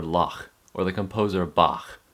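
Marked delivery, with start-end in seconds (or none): 0.0-0.5 "lach" ending in a gargly back-of-throat CH; throaty CH. Shown as a demonstration. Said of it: "say it like the Scottish word". 1.5-2.0 "Bach" with a gargly final CH; throaty CH. Shown as a demonstration. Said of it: "It's in the back of your throat".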